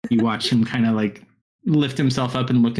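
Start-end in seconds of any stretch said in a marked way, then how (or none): none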